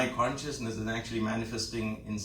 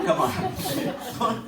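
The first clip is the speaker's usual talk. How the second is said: high laughing "come on"; 10 semitones higher, 5 dB louder than usual. Real, not acted. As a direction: burst out laughing mid-phrase. Laughing at Swami's odd remark.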